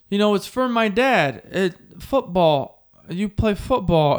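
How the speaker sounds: slow, awkward fan explaining; stammering fan. Retold or imitated. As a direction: drag the words out nervously. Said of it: "And he goes".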